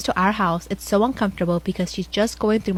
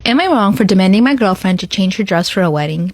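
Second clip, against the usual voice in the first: ordinary talk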